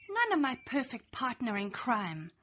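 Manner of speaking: ordinary delivery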